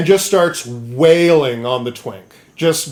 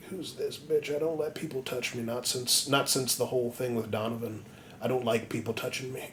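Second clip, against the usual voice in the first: much softer, half-awake grumbling; feverish mutter; 2 semitones lower, 16 dB quieter than usual. Retold or imitated. Feverish Guts waking beside Casca, soft. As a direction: mumble it through fever.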